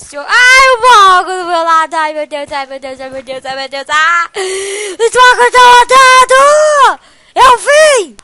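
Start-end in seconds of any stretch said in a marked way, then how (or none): 3.9-8.2 loud, high scared yell; frightened shout. Real, not acted. Player spooked by blocks moving, frightened high shout.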